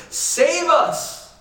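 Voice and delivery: high, loud cry of save us; urgent plea. Retold or imitated. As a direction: call save us out like men about to drown. Disciples cry out in storm.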